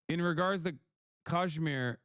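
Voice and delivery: usual speaking voice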